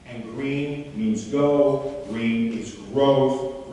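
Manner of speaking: very slow, each word weighted; slow emphatic. Shown as a demonstration. Coach tells volunteer green means go, very slow. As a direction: land each word slowly.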